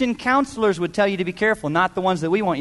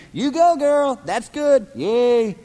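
chirpy high cheer, hammed up; mock cheerleader; 5 semitones higher, slower than usual. God booting you out with a mock cheer. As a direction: cheer them on like a sideline fan.

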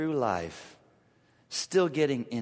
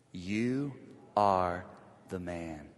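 softer, low, pausing on each word; dramatic hushed line; 8 semitones lower, 5 dB quieter, slower than usual. Shown as a demonstration. Nathan confronting David with his sin, hushed and low. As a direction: let each word land in tension. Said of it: "tension, say it with me".

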